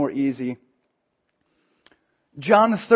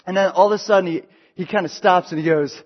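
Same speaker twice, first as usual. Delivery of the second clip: ordinary talk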